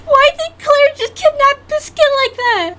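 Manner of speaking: high squealing voice through real laughter; laughing incredulously. Real, not acted. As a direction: crack up while asking in disbelief. Cracking up at own animation.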